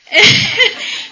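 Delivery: high burst of laughter; sudden big laugh; loud laugh. Real, not acted. Laughs at mom's surprised reply.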